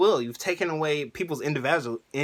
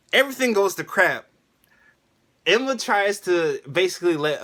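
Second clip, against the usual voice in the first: ordinary talk, nothing marked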